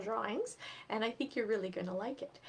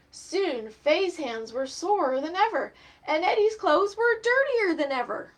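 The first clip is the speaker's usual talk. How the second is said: lively, higher storybook reading voice; reading to children; 6 semitones higher, 9 dB louder than usual. A narrator's reading. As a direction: read it aloud to little kids, bright and animated. Reads picture book to children.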